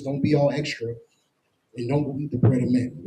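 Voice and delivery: normal talking voice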